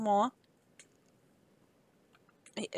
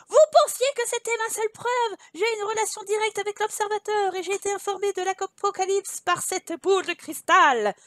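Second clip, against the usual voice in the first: loud, high proclamation; impassioned raised-voice speech; crying out; 6 semitones higher, 5 dB louder than usual. A narrator's reading. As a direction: declaim it to a crowd from a tabletop. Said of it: "commença à crier".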